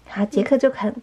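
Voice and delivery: plain reading voice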